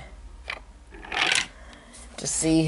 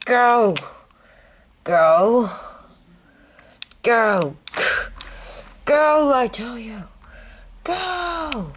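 frantic begging; 10 semitones higher, slower than usual. Acted. Victim begging at the cliff.

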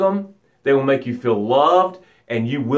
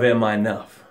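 sinking low; 6 semitones lower than usual. Acted. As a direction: let the question drown. Drowning in the ocean of doubt.

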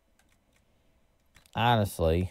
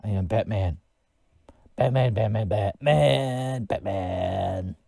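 mock Batman, only slightly gruffer than usual; lightly gravelly Batman impression; slower than usual. Shown as a demonstration. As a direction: say "Batman" like a half-hearted caped crusader. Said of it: "That's my gravelly voice".

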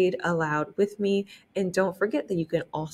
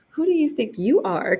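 higher, louder, fast proud retort; indignant outburst; haughty cry; 7 semitones higher, 3 dB louder, faster than usual. Acted. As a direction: snap it like a vain show-off fish. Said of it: "cried the Rainbow Fish".

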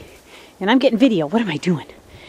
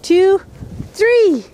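high, loud count to go; excited countdown; 8 semitones higher, 4 dB louder than usual. Real, not acted. Counting the dog into another jump, excited. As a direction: launch the numbers up high and loud.

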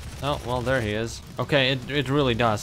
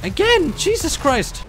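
frustrated yell; 6 dB louder, slower than usual. Real, not acted. Dies again in game.

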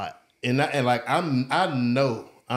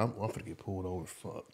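fast, much softer, lower under-breath curse; muttered dread; 6 semitones lower, 13 dB quieter than usual. Retold or imitated. Driver mutters to himself fearing the cops, fast and low. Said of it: "'cause I'm like".

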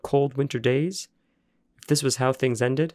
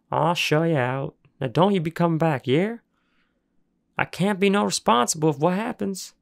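higher, rough backwoods man warning; gruff warning; 6 semitones higher than usual. Acted. Junkyard man escorting boys out, gruff warning. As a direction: send them off with a warning.

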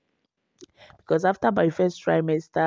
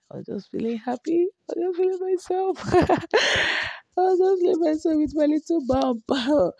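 high, fast and giggly about the bump; giddy; 9 semitones higher than usual. Real, not acted. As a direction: squeal it out with delight. Giddy over her baby bump.

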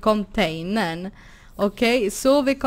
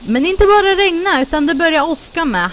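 excited alarm; 7 semitones higher, 7 dB louder, faster than usual. Real, not acted. Storm starts in game.

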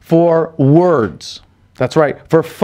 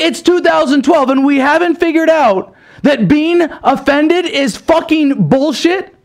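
real exasperated shouting; angry rant; 6 semitones higher than usual. Real, not acted. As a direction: blast it out like you can't believe it. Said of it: "I'm pissed off".

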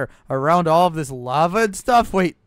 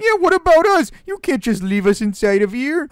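lightly voiced turkey; 8 semitones higher than usual. Captive turkey protesting at being left, high alarmed voice.

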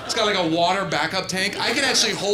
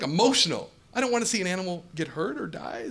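ordinary talk, nothing marked

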